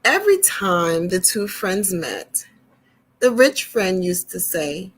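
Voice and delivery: gentle storyteller reading to little ones; storybook reading. Acted. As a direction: read it like a picture book to kids. Reads storybook to children.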